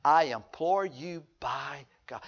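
slow, soft begging voice; a hushed, drawn-out plea; pleading demon. Retold or imitated. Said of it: "The demons are".

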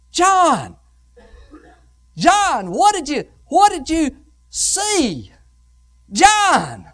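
high, ringing pulpit call to John; dramatic calling with long pauses. Acted. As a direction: call the name out as if to summon him.

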